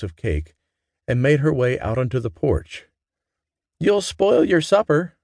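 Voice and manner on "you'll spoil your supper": high, slow motherly warning; mother warning her daughter about supper. A narrator's reading. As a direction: wag a finger with your voice. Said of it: "Mama warned her".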